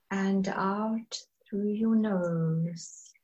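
slow, soft meditation voice with long pauses; guided meditation. Acted. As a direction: ease them gently into calm, drawing out the words.

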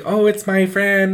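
put-on high-pitched childish voice; imitated child's breezy explanation; innocent little kid. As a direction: chirp it like a small child. Acts self as child explaining.

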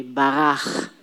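Barach ending in a long throaty kh; repeat-after-me Hebrew. Shown as a demonstration. Teacher has class repeat, Barach with a drawn-out guttural ending. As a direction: model Barach clearly, scraping the final ch. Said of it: "say that one after me".

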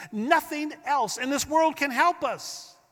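lifted high on the word world, pressing the point; emphatic high declaration. Acted. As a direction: proclaim it up high with conviction. Preacher repeats key line.

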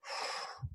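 a long, drawn-out mock sigh, imitating a toddler; toddler's exasperated sigh. Toddler son sighing back at his dad, imitated long sigh. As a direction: sigh like a tired two-year-old fed up with dad. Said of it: "looks at me and goes".

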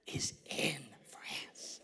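slow hushed whisper, drawn out. Shown as a demonstration. To the congregation, slow whispered punchline. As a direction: whisper it slowly like a secret. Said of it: "say it with me".

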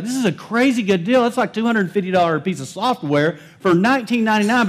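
high-pitched, thrilled bargain-hunter; excited buyer. Acted. Buyer finding a cheap software program online, excited. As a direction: gush over an unbelievable bargain. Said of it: "You're so excited".

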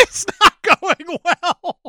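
high, loud, slow fake wail; badly faked sobbing whine, stretched out; mock crying. Host mocking actor pretending to cry, fake wail.

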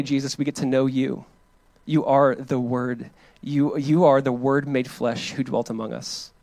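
soft, earnest prayer voice; gentle pastoral prayer. Acted. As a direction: pray it quietly, leaning in. Leads congregation in prayer.

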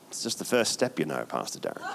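mock the cautious listener; sceptical aside. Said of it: "Some of us are going".

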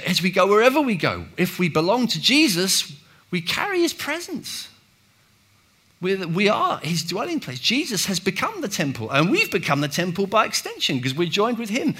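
preacher building to a high point; rising preaching. Acted. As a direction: preach it with lift.

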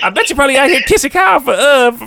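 mock cartoon voice. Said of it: "He said".

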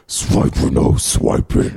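whispered sneaky weasel voice; sly hissing weasel. Retold or imitated. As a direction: hiss it slyly like a sneaky fox. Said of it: "nasally, like, weasel voice".

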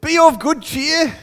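high, slow, mock-English cheer; loud, lofty English encouragement. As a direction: put on a hammed-up English voice. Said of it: "It's very, very English".

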